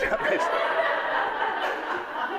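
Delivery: squeaky high-pitched laugh; high helpless giggle. Real, not acted. Laughing during demo.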